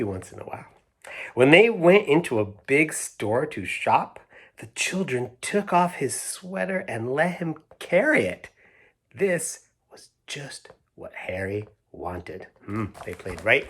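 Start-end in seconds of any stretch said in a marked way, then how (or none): 1.3-8.5 put-on reading to children. A narrator's reading. Reads picture book aloud. 9.1-12.5 slow conspiratorial whisper; storybook whisper. A narrator's reading. Revealing Harry's sneaky plan to listening kids, whispered.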